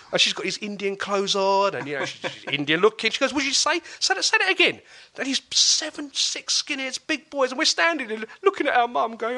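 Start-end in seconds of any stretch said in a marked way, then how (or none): 3.3-4.8 much higher and louder, squaring up; a tiny fierce woman daring them to repeat it; confrontational challenge. Retold or imitated. Mum challenges the skinheads, loud high furious challenge.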